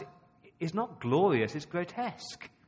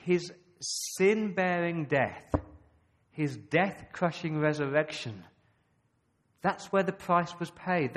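higher, slowed, emphatic proclamation; impassioned preaching; 4 semitones higher, 4 dB louder than usual. Acted. Preacher proclaiming the cross to his church, impassioned. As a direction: build it like a sermon climax.

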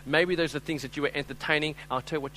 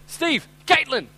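slow, drawn-out warning names, higher and louder; stern mother's call; 9 semitones higher, 5 dB louder, slower than usual. Retold or imitated. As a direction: call the names in that tone every husband fears. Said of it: "She just said".

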